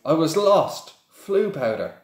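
put-on relieved boy explaining himself. A narrator's reading. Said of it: "Harry croaked in relief".

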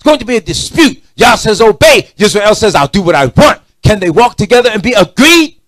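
loud, high preacher's cry; fiery preaching. Acted. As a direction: preach it out at full force. Preaching at full force.

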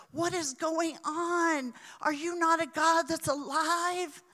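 high, loud anguished pleading to God; desperate prayer. Retold or imitated. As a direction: cry out to God in despair, voice high and strained. Pastor voicing desperate all-night prayer.

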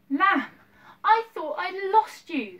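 high, loud, slow relieved exclaim; a girl hugging her found lamb, relieved; relieved cry. Acted. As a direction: pour out the relief of finding him.

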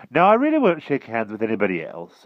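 announcing. A narrator's reading. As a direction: declare it emphatically. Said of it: "announced the monarch".

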